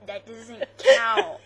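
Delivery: loud, slow, laughing protest. Real, not acted. Laughing objection to VeggieTales.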